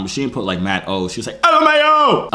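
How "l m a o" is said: very high-pitched acted yell. Teller repeating her texted reply, yelled high. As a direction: squeal the letters out. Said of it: "She yelled it this time".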